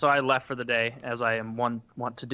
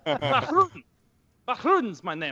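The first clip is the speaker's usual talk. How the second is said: put-on Eastern European accent; 10 semitones higher than usual. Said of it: "that Eastern European accent".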